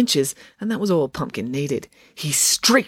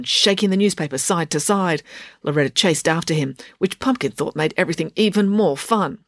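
ordinary delivery

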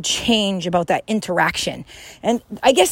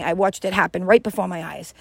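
normal talking voice

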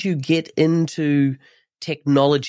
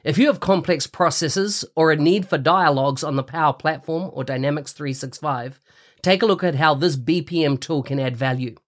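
ad read. Acted. Sponsor ad read on podcast.

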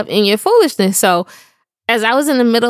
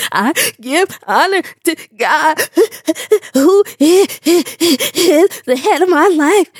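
hammed-up sobbing testimony, broken by hiccupy gasps; mock-cry, higher, catching breath between words; little kid crying; 5 semitones higher than usual. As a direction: choke out a testimony like a sobbing child. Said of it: "when you little crying".

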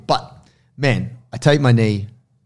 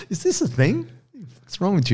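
incredulous onlookers; 9 semitones higher than usual. Retold or imitated. People reacting to shaved knee.

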